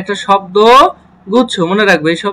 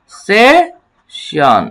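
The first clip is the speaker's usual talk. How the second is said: Session drawn out very slowly for the class; very slow model word; much slower than usual. Shown as a demonstration. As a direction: stretch session long and even. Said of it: "কি দাঁড়াচ্ছে?". (খুব ধীরে টেনে বলা)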